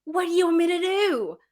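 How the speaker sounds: high pleading exasperation; exasperated. Retold or imitated. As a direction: at the end of your rope. Exasperated at rally heckling.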